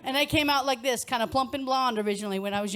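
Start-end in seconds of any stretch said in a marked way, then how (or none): none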